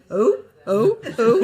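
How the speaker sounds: loud, high disappointed groan; mock audience groan. The whole audience groaning at the understudy news. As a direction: groan loudly like a letdown crowd.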